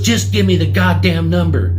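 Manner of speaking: loud, high-pitched customer outburst; imitated furious demand; exasperated yell. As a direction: yell it like a customer at the end of their rope. Customer's final angry demand, retold.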